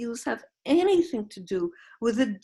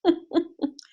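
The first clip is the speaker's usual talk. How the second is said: short high laugh; 10 semitones higher, 4 dB louder than usual. Real, not acted. Laughs after own joke.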